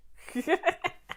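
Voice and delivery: short high rising laugh; a quick delighted laugh. Real, not acted. Recalling driving through Ottawa yesterday, short high laugh.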